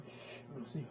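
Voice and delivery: put-on soft whisper to calm a child; big sister hushing her little brother; hushed whisper. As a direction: shush him gently.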